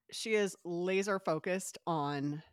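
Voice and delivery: ordinary talk, nothing marked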